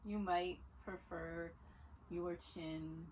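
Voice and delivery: calm, unhurried instruction with long pauses; guided yoga voice. Real, not acted. Yoga teacher easing students into Sphinx pose, calm guiding voice. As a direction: softly guide them through the pose, leaving room to breathe. Leads a gentle yoga practice.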